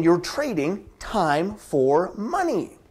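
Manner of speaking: slow, each word spelled out, falling on "money"; slow emphatic. Shown as a demonstration. Coach hammering home the point to listeners, slow and emphatic. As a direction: drive it home slowly. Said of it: "Because again".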